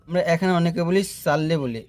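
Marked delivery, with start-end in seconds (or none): 1.2-1.6 সাল্লি with a thin s and open a; light s opening সাল্লি; wrong light sin. Shown as a demonstration. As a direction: say সাল্লি with sin in place of sad. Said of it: "সদের উচ্চারণটা সিনের মতো করে ফেলি".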